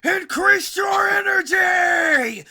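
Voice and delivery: high, slow, loud shout; Seventeen's shout. Retold or imitated. Seventeen ordering Frieza, high loud shout. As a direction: bellow the order. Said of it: "he's like".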